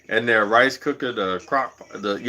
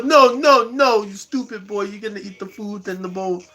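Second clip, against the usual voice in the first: put-on strict Jamaican accent; 8 semitones higher than usual. Strict parent correcting a confused boy, in a Jamaican accent. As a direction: scold like an exasperated Jamaican parent, pitched way up. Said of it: "they were strict".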